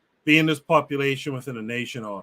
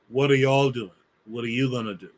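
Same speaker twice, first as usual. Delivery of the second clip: usual speaking voice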